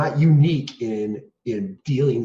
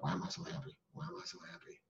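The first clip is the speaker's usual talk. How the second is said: without inflection; 4 semitones higher, 20 dB quieter, faster than usual. Retold or imitated. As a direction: say it half-heartedly, barely audible. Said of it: "I say it without much inflection in my voice".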